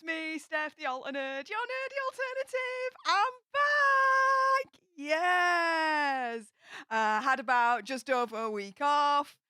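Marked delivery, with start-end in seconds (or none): 3.1-4.8 a high, gleeful I'm back; big happy comeback cheer; excited. Real, not acted. Return to stream after break. 5.0-6.4 joyful shouted yes; triumphant little yell; cheer. Real, not acted. Celebrating being back.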